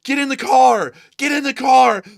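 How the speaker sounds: loud, high cop yelling; mock police shout. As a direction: order him into the car. Acting out police arrest.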